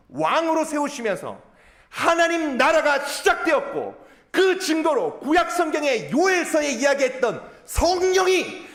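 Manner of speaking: loud, high, slow proclamation; full-force preaching. Acted. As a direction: build to a ringing declaration with weighty phrases. 베드로 설교를 힘주어 재연. (힘주어 크게 선포하는 설교)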